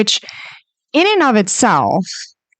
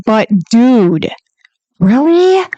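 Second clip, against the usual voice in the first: attitude; 2 semitones higher, 3 dB louder, slower than usual. Real, not acted. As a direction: let the attitude out. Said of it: "try real hard not to have an attitude".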